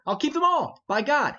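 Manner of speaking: loud, high, hot-headed refusal; I'll keep them all, shouted high; defiant outburst. Acted. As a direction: fling it back in defiance.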